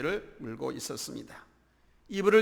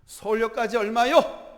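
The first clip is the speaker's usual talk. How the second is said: raised, louder voice asking the fare; calling out a question; 6 semitones higher, 8 dB louder than usual. Acted. Student asks rickshaw puller the fare, calling out. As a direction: call up to the driver, pitch lifted.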